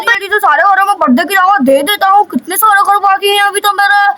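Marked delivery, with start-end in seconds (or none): none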